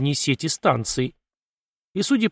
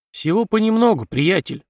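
higher-pitched, lightly ironic reply; 6 semitones higher than usual. A narrator's reading. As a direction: answer with a touch of irony. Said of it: "иронично хмыкнул Артём в ответ". (с лёгкой иронией)

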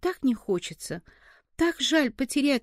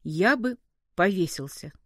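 usual speaking voice

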